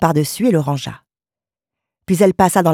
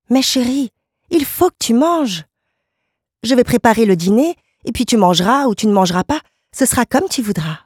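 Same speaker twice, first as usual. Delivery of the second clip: high, swooping wifely plea; insist, pitched well up; devoted wife, pleading insistence; 7 semitones higher than usual. Acted. Wife urging her husband to eat dinner, anxious insistence.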